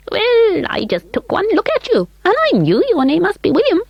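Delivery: very high, piping call; high little elf calling. A narrator's reading. Mister Munty voiced.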